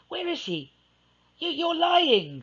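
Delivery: high, stammering accusation; Alice calling Edward a liar, high and upset; Alice accusing. Acted. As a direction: accuse him, voice climbing. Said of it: "she replied".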